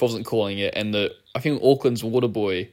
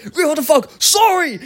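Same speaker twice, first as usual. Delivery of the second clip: high, loud imitation of an irate water boy; yelled apology; 4 dB louder than usual. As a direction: rage at the ref, then yell a curt sorry. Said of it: "yells at me and moves".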